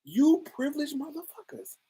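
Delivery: high, breathy-free disbelieving jab; incredulous sneer. Retold or imitated. Watching privileged artists, she mocks them in a high incredulous voice. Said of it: "you looking at this like".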